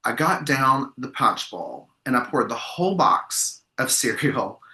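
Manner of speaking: normal talking voice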